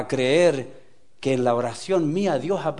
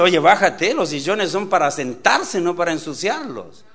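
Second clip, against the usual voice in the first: loud parental telling-off, easing toward the end; mock scolding; 6 dB louder than usual. Preacher showing how to correct a child, acted scolding. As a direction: scold like a firm mother. Models a mother scolding her son.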